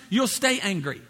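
understated, unbothered verdict; God's matter-of-fact answer. Acted. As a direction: answer calmly, letting them have their way. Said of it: "God says".

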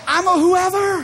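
high, loud "I'm a whoever"; preacher's loud joyful peak; excited declaration. Real, not acted. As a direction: shout it with joy. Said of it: "preaching climax".

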